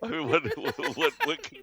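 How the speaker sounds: real giggles interrupting the words; laughing while talking. Real, not acted. Comedian unable to finish his joke, laughing. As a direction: stammer through laughter. Still cracking up.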